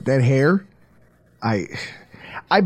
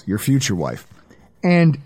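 ordinary delivery

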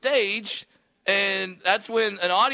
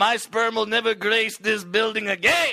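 proud mock Mexican parting shot; mock Mexican accent; 4 semitones higher than usual. Heckler leaving the club, in a mock Mexican accent. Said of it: "in that accent".

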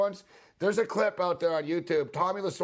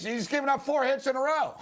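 mock ranting manager; 11 semitones higher than usual. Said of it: "He goes".

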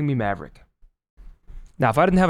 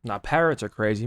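ordinary delivery

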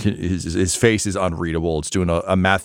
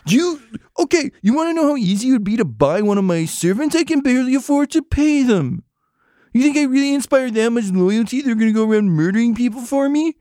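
unhinged rant. Acted. Broke noble ranting at the investigators, high and unhinged. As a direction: lose it completely, voice cracking upward. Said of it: "unhinged Steve Martin at the end of a Steve Martin film rant".

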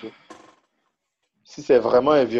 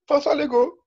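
high announcer voice. Pretend. Mock-announced name.

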